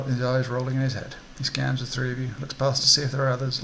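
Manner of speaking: ordinary delivery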